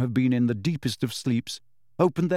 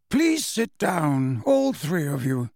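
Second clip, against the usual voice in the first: lightly voiced headmaster; 3 semitones higher, slower than usual. Dumbledore asks all three to sit, slowly and lightly voiced. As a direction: give a polite, unhurried order.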